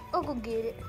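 ordinary talk, nothing marked

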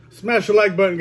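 ordinary talk, nothing marked

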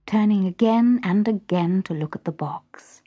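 ordinary delivery

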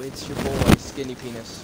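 mock deep intro.

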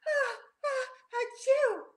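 high-pitched fake achoo; squeaky storybook sneeze; acted sneeze. A narrator's reading. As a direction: build up to a pretend sneeze. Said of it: "Rocky sneezed".

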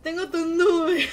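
loud line breaking into laughter; laughing. Real, not acted. Streamer recalling the threat to Kevin, laughing. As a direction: crack up while saying it.

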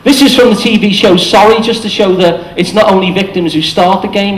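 ordinary talk

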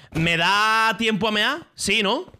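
drawn-out plea. Real, not acted. Desperate to pee before the server returns, slow high plea. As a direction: stretch the question out, pleading. Asking urgently, needs the toilet.

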